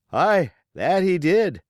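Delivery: high, loud grim agreement; another pirate. Acted. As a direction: pitch it up as a rough shipmate.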